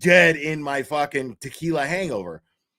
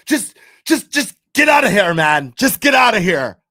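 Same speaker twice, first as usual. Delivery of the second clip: loud, high, exasperated yelling; angry outburst; 4 semitones higher, 10 dB louder than usual. Retold or imitated. Furious boyfriend throwing the stranger out, angry yelling. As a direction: stammer on the first word, then yell.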